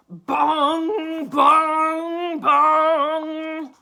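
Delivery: loud ringing bong, long and slow; bell bong. A narrator's reading. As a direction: boom it out like a big church bell. The church bell rings.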